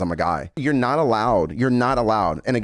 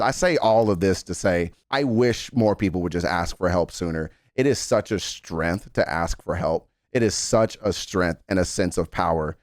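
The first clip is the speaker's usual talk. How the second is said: normal talking voice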